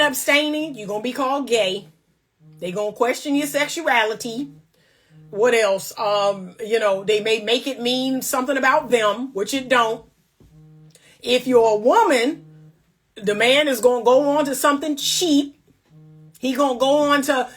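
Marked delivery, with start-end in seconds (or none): none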